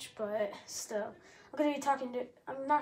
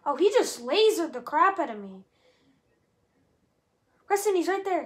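loud, high, frantic gamer shout; excited yelling; 4 semitones higher, 9 dB louder than usual. Real, not acted. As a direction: yell it mid-fight, panicking. Frantic gaming fight.

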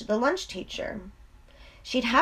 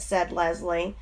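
ordinary delivery

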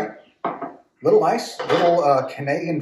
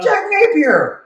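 sudden loud, high cry of recognition; excited outburst; 7 dB louder, slower than usual. Real, not acted. Host realizing the distiller is the Joker, excited shout. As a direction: shout the name as it dawns on you.

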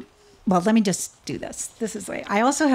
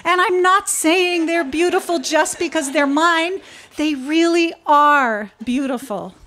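high, loud gushing pride; a mother showing off her children; proud mother turtle; 8 semitones higher, 5 dB louder than usual. Acted. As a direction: crow about your children. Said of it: "the turtle cried".

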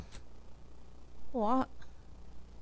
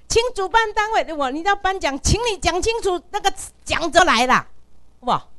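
retold demand; 8 semitones higher, 5 dB louder than usual. Repeating demand to organizers.

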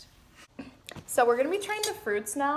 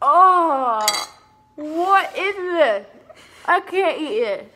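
disgusted; 3 semitones higher, 8 dB louder, much slower than usual. Real, not acted. Tasting gross fruit.

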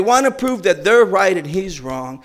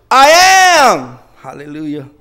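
joyful shout; 9 dB louder, slower than usual. Retold or imitated. Healed man to the crowd, a loud joyful shout. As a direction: shout it out like a man who can see.

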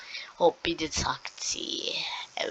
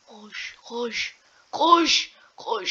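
frantic; 5 semitones higher, much slower than usual. Real, not acted. Gamer yelling at the character to run, frantic. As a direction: yell it in a panic. Panicked urging in game.

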